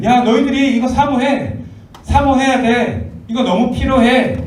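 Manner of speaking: insistent urging. Acted. Apostle telling believers they must desire gifts, loudly insistent. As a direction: press it on them loudly.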